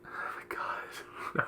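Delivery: slow, whispered oh my God; whispered exasperation. Real, not acted. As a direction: breathe it out in disbelief. Exasperated at joke.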